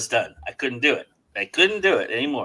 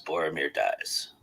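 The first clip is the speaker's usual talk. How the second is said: lower, softer, slow, deadpan "Boromir dies."; deadpan flat; 8 semitones lower, 10 dB quieter than usual. Shown as a demonstration. Imitating a flat AI narrator announcing Boromir's death, lower, softer, slow.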